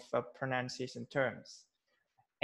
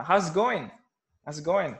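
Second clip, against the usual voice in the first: louder, casual Aussie greeting; Australian accent; 3 semitones higher, 7 dB louder than usual. Shown as a demonstration. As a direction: run "how's it going" together like an Australian. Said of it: "Something like that".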